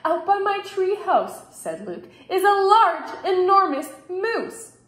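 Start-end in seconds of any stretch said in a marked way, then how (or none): 0.0-1.5 put-on high, urgent child's voice; excited child. A narrator's reading. Boy urgently reports the moose. 2.3-4.8 put-on high, loud child's voice; excited child. A narrator's reading. Boy urgently reports the moose.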